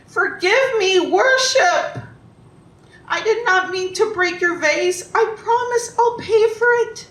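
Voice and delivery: high, loud begging for forgiveness; frantic pleading. Acted. Carpenter to emperor as the sword comes down, frantic high pleading. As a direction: plead loudly for your life, pitch way up.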